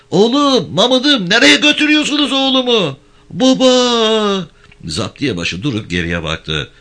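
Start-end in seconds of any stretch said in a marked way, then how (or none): 0.1-3.0 much higher, louder anguished cry; wailing mother. Acted. Mother wailing as gendarmes take her son away. 3.3-4.5 high, loud call for father; crying out. Acted. Child calling out as father is taken away.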